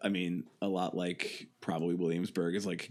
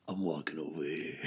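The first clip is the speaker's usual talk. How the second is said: a softer, mock tourist New York voice; New York accent; 4 semitones lower, 8 dB quieter than usual. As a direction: put on a New York accent, low-key. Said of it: "comes to New York and goes".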